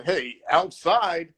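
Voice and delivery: high, drawn-out pretend warning; mock call-out. Skater showing why worded warnings fail, mock drawn-out call-out. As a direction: call it out slowly and rising, hammed up. Acts out an unworkable spoken warning.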